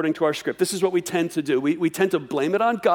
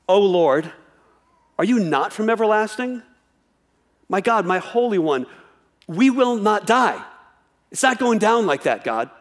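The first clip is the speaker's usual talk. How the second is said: raised, urgent appeal to the Lord; fervent prayer reading; 4 semitones higher than usual. Acted. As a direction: cry out the verse with conviction. Reads Habakkuk's prayer with fervor.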